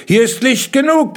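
high, loud insistence; insistent retort. A narrator's reading. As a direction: insist against their objection. Kalle argues with the children.

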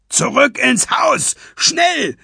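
high, loud command; urgent shout. A narrator's reading. Skullduggery orders the others indoors, shouting urgently. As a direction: shout them back to safety. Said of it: "rief Skullduggery".

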